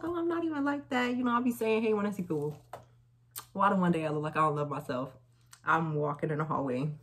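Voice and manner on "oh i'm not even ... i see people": much higher, sugary innocent tone; sweet innocent voice. Retold or imitated. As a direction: play it sweet and innocent to the guy. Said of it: "So I was like".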